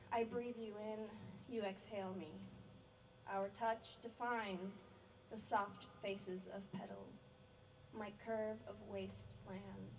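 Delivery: slow, measured poetry recital; deliberate verse with drawn-out line endings; love poem reading. Acted. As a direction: recite a love poem, unhurried, pausing between phrases. Reads love poem for husband.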